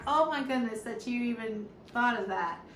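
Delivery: ordinary talk, nothing marked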